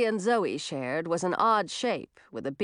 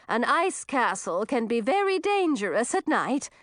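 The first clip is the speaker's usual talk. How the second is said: syrupy hostess witch, pitched up; 3 semitones higher than usual. Acted. Old witch speaking to guests.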